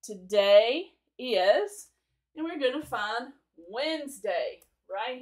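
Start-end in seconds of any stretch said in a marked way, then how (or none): 0.0-1.9 drawn-out words for English learners; slow and clear. Shown as a demonstration. Teacher slowing down for students learning English. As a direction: stretch each word out for learners. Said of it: "for all of my friends out there learning English".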